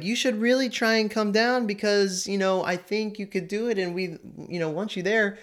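Cindy Lou Who coaxing the Grinch to come down; little girl voice. Retold or imitated. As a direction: plead sweetly like a small girl. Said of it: "She's like".

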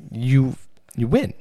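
ordinary delivery

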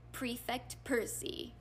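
very soft, slow grumble; muttered. A narrator's reading. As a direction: mutter it under your breath, slowly. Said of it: "muttered Fred".

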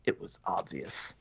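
breathy smug aside, barely voiced; smug whisper. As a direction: whisper it smugly, like it's obvious. Retelling arrogant doctor.

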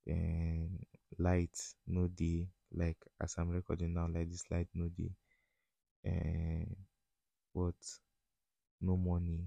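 usual speaking voice

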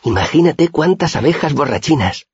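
very high-pitched, marvelling little voice; wondering admiration. A narrator's reading. Auri admires the honey wine, high-pitched wonder. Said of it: "Miró la botella con admiración".

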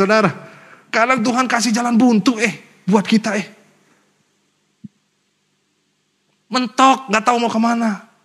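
high, fervent sermon delivery; impassioned preacher lifting the congregation; preaching at full force. Acted. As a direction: preach it up to the whole hall. Preaching run at high pitch.

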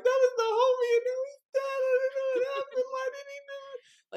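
high, wailing, crying voice; hammed-up sobbing over a dead friend; mock hysterical crying. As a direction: sob it out in a high wail. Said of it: "And I'm, like, crying, I'm in hysterics".

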